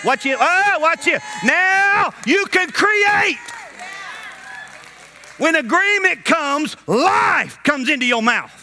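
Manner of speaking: high, stretched-out preacher's cry; full-force preaching. Real, not acted. Preacher urging church to come into agreement, full-force preaching. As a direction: build to a ringing proclamation.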